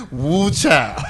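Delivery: a real belly laugh on the words. Real, not acted. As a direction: burst out laughing at the punchline. Laughing at the punchline.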